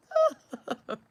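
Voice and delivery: loud burst of laughter. Real, not acted. Laughs at stream glitch.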